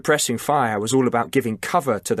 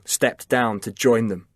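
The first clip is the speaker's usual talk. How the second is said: ordinary delivery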